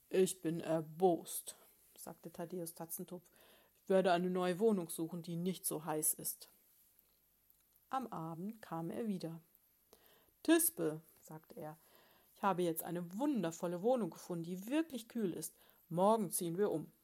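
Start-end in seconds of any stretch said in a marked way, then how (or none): 0.1-1.6 loud, slow, cross declaration; the bear husband, announcing his anger; indignant grumble. Acted. 3.8-6.5 stubborn loud bear. Acted. Bear announces move. 10.4-16.9 proud loud bear. Acted. Bear announces new home.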